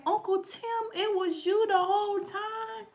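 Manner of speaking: emotional reunion with her late uncle, overjoyed; joyful recognition. Retold or imitated. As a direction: call out to him in sudden joy. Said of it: "I'm like".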